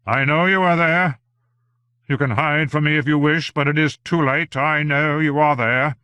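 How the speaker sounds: high, loud cry to a hidden watcher; loud, high-pitched, insistent challenge. A narrator's reading. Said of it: "Strange raised his head and cried out".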